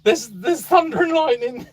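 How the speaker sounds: very high, squeaky imitation of a frightened dog; excited, scared dog. Dog waking owner during a storm, excited and scared. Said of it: "he was so excited and scared".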